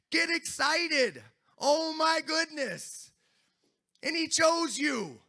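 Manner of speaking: loud, high, excited revival preaching; fiery preaching. Acted. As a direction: rouse the congregation. Preaching at full force.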